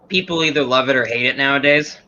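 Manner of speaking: ordinary delivery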